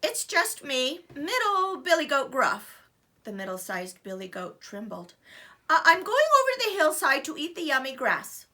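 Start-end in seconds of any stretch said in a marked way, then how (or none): 0.0-2.8 nervous goat voice. Acted. Middle goat trembles. 5.7-8.5 nervous goat voice. Acted. Frightened middle goat.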